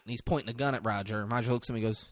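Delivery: usual speaking voice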